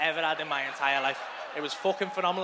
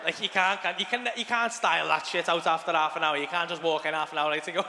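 usual speaking voice

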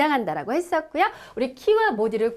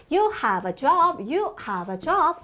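slow model sentence; much slower than usual. Shown as a demonstration. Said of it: "어를 붙이자".

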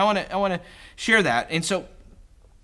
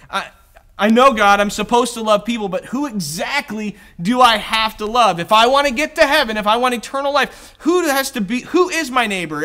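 louder, higher, pushy questioning; indignant self-justifying man; 4 semitones higher, 7 dB louder than usual. Retold or imitated. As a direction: hammer him with anxious loophole questions. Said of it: "is basically what he is saying".

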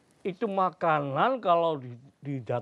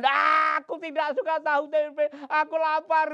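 high loud spoiled whine; whiny child; 14 semitones higher, 7 dB louder than usual. Acted. Gundul refusing the mother's leftover rice, high whine.

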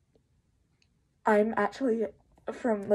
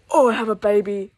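excited; 11 dB louder than usual. Real, not acted. Finding a wished-for toy.

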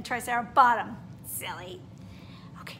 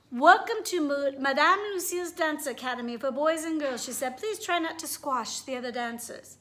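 lively storybook read-aloud with character voices; reading to children; 3 semitones higher than usual. A narrator's reading. Teacher reading a picture book to her class, animated. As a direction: read it like story time for little kids.

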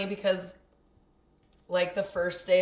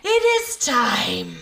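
loud, slow proclamation; raised, ceremonial film-character voice; Rafiki; 4 semitones higher, 7 dB louder, slower than usual. Retold or imitated. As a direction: proclaim it grandly, drawn out. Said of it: "Like Rafiki says".

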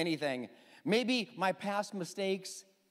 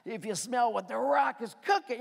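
pumped-up wrestler bravado; 3 semitones higher than usual. Retold or imitated. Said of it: "He's like".

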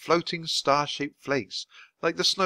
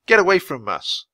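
loud, raised warning; yell; 6 semitones higher, 7 dB louder than usual. A narrator's reading. Jack yelling at the teenagers to leave, loud. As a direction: drive them off with your voice. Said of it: "just yelling and kicking".

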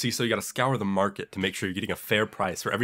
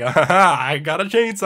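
higher and louder hammed-up menace; mock chainsaw threat; 6 semitones higher, 6 dB louder, slower than usual. Neighbor announcing his chainsaw, louder mock glee. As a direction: gleefully threaten with a chainsaw, in jest. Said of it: "he's like".

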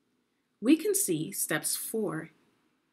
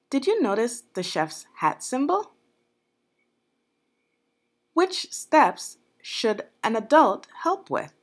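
louder quiz question; 5 semitones higher, 8 dB louder than usual. Acted. Teacher quizzing children, louder.

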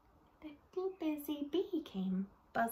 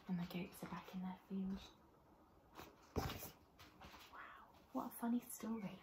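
whisper; 3 semitones lower, 16 dB quieter, faster than usual. Real, not acted. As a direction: share a secret softly and quickly. Hushed aside to children.

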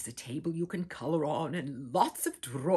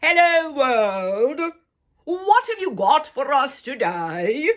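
big booming hello, much louder and higher; real loud voice; 7 semitones higher, 14 dB louder, slower than usual. Acted. Poppy greeting the morning from the window, booming loud. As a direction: bellow it out to the whole world. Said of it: "in a real loud voice".

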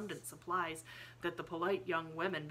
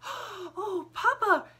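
put-on very high, loud little-girl outcry; child's high-pitched exclamation to her father; excited cry; 11 dB louder, much slower than usual. A narrator's reading. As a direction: cry out in thrilled discovery. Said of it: "she cried".

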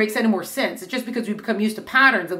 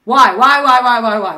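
much louder, insistent repeated whys; mock nagging why; 2 semitones higher, 9 dB louder than usual. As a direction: pester like a child who won't stop. Mock endless questioning child.

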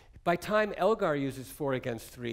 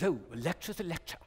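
imitating English composer. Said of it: "He goes".

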